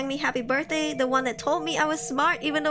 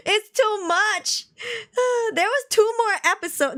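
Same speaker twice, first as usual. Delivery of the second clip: exasperated; 8 semitones higher, 4 dB louder than usual. Real, not acted. Exasperated at long story.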